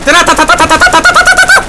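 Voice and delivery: loud, very high rapid rattle; mouthed machine-gun fire. Pretend. Gamer imitating his gunfire, high rattle.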